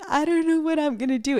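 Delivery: high-pitched mock-helpless wail; playful panic. Asking viewers whether nails grow back, playful high panic. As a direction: whine it high, playing up the worry. Playing up worry over lost nail.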